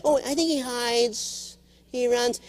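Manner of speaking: lightly voiced little kid. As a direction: muse like a small boy. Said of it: "He goes".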